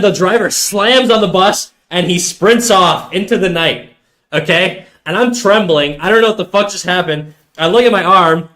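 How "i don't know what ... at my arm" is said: ordinary delivery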